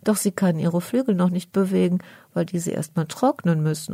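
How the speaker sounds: plain reading voice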